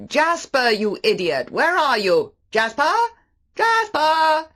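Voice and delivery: loud, high calls across the beach; shouting for the dog. A narrator's reading. Maxim calling his dog across the cove, loud shout. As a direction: call the lost dog at full voice.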